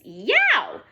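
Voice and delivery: ordinary delivery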